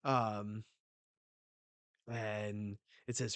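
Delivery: usual speaking voice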